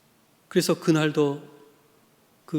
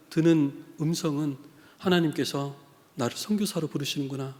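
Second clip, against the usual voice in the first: ordinary talk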